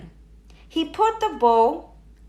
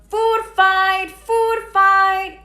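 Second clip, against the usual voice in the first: much higher, louder chant; yelling; 8 semitones higher, 7 dB louder, much slower than usual. A narrator's reading. Toad twins yell across the dining hall, loud. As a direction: yell it to start the chaos. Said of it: "the Toad twins yelled".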